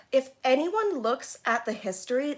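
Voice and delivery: ordinary delivery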